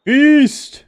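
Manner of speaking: high, loud mock battle cry; mock cheer. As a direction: bellow it like a hammy feast call. Mocking the feast cheer.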